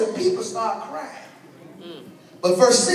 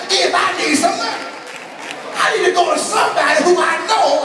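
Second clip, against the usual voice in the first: full-force preaching; 5 semitones higher than usual. Acted. Preacher driving the point home to the church in a raised preaching voice. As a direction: preach it, slowing down on help you.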